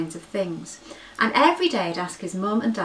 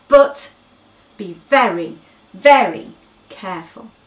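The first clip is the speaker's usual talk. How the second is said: loud, emphatic caution; loud stressed very careful; urgent warning; 11 dB louder than usual. Acted. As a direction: press every word as a warning.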